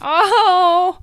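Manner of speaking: mock swoon.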